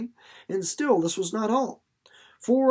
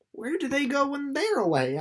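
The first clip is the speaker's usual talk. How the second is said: put-on higher, wide-eyed girl's question; young girl Winnie; 7 semitones higher than usual. A narrator's reading. Winnie asks May about the boys, higher curious girl voice. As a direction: ask it like an amazed young girl. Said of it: "asked Winnie".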